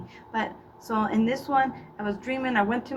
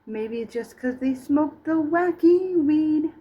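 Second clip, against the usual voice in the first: stoner drawl; 6 semitones higher, 5 dB louder, slower than usual. Acted. Stoner voice, slow.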